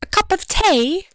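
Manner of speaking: high-pitched British caricature; put-on British accent. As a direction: lilt it high like a cartoon Brit. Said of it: "This isn't meant in a mocking way".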